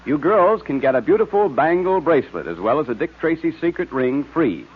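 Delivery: ordinary talk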